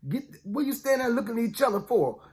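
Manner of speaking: a hint of an old father hurrying his sons along; father ordering sons. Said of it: "he said".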